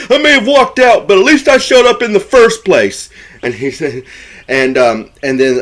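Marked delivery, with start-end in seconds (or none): 0.1-3.1 high-pitched, heated mock retort; arguing. Retelling Bill arguing with Joe in the truck, mock angry. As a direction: fire back like a hurt ex in an acted quarrel. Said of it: "Bill and Joe start arguing".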